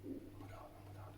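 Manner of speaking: breathless whispered "oh my God" repeated; excited whisper. Retold or imitated. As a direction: whisper it like you can't believe your luck. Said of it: "I was like".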